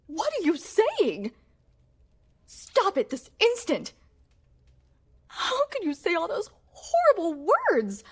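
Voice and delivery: rising from a shocked question into a high, loud, tearful shout; furious outburst. Acted. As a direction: scream at him, half crying with rage. Queen rejected by her silent husband.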